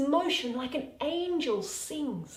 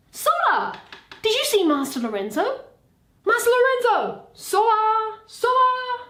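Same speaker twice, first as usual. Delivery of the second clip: loud, high calls across the dark; hollering; 10 semitones higher, 7 dB louder than usual. Acted. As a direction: bellow the name out to someone far off. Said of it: "Leave hollering".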